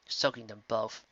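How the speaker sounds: plain reading voice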